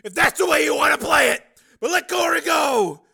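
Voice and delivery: high, very loud, urgent bargaining; shouted plea. Acted. Man bargains for a girl's release, shouted plea. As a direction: shout it across a distance, pleading.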